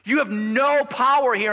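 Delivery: high, loud, slow-stretched denunciation; thundering preacher ordering demons down; fierce rebuke. Acted. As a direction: berate the demons, building to a shout.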